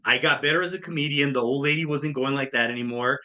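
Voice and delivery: ordinary talk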